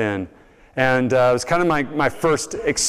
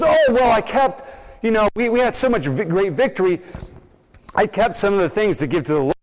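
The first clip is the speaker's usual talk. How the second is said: higher, fast stumbling excuse; flustered excuse; 6 semitones higher, faster than usual. Retold or imitated. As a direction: scramble to justify yourself. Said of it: "He goes".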